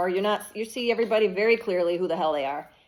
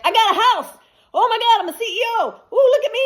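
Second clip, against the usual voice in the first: much higher, louder gleeful boast; bragging comeback; 13 semitones higher, 6 dB louder than usual. Retold or imitated. Voicing the viewer showing off to doubters, high gleeful brag. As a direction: crow about your new life. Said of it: "But then you come back".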